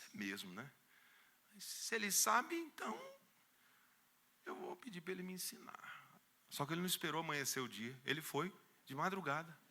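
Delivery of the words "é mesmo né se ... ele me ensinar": soft rising then whispered resolve; hushed discovery; dawning realization. Retold or imitated. Realization in the night.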